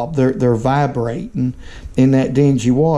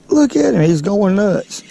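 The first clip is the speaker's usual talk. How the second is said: excited; 6 semitones higher than usual. Real, not acted. Excited at hooked catfish.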